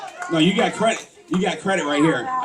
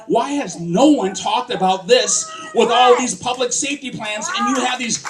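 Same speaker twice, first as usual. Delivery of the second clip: raised, higher, indignant rally cry; impassioned challenge; 6 semitones higher, 4 dB louder than usual. Real, not acted. Candidate challenging the crowd on ignored youth crime, impassioned. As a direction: call it out forcefully to the crowd.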